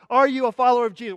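loud, rapid interrogation; acted interrogator. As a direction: fire the question at a prisoner.

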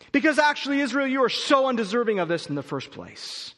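loud, high, emphatic sermon voice; forceful preaching. Acted. Preacher pressing scripture on the congregation, loud and emphatic.

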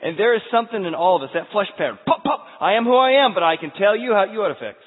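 high, loud, driving sermon; fiery preaching. Acted. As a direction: build it up like a revival preacher. Preaches at full force.

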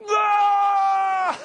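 long, high, loud mock scream; comic screaming wail on one high note; imitated child's scream. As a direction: erupt into a sustained hammed-up kid's shriek. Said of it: "just erupted into".

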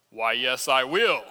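put-on child answering as God. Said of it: "he says".

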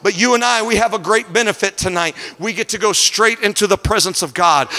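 climbing sermon cadence over the organ; rising pulpit fervor, held strong; preaching at full force. Acted. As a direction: preach it home to the congregation. Preaching to congregation with music.

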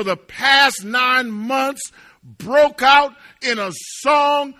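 loud, mounting proclamation; forceful preaching. Real, not acted. Preacher proclaiming the mute man's song of praise, loud and triumphant. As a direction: pile up the phrases, then slow and hammer them.

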